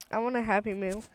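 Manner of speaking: ordinary talk, nothing marked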